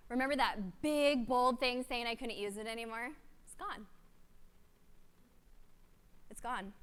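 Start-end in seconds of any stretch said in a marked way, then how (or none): 3.4-6.7 awed hush. Real, not acted. Amazed the suspension vanished.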